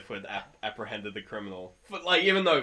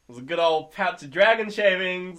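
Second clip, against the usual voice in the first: raised, showy handover; triumphant presenting; 9 semitones higher, 12 dB louder than usual. Acted. Player presents drugs with flourish.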